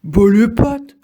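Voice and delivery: loud, high bark at the bird; snapped warning. Acted. Trognon warns the bird off, loud and high. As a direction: shoo the bird off sharply. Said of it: "lance Trognon".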